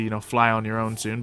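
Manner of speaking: normal talking voice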